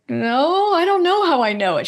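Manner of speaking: baffled denial. Retold or imitated. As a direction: insist you have no idea how. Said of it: "And I'm like".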